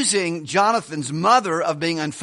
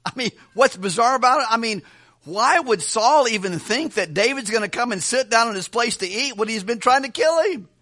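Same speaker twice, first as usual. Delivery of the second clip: usual speaking voice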